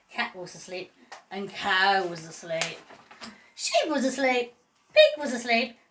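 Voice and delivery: usual speaking voice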